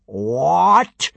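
loud bellow rising in outrage; incredulous roar; furious bellow. A narrator's reading. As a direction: bellow it, swooping upward. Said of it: "he bellowed".

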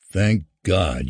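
usual speaking voice

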